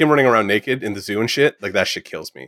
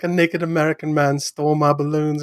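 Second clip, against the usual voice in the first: higher-pitched mock child voice; put-on boy's voice, raised pitch; imitated little boy; 6 semitones higher than usual. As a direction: report it like a kid to mom. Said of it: "his line when he goes to his mom".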